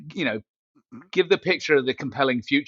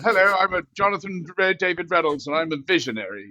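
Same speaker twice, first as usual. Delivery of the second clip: mock grand self-introduction, pitched higher; pompous intro; 7 semitones higher than usual. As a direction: announce yourself like a self-important big shot. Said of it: "it does sound very pompous".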